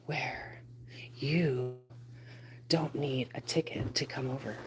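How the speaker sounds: breathy intro whisper, unvoiced; spooky late-night whisper. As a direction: hush it like a secret welcome. Whispered intro before the live.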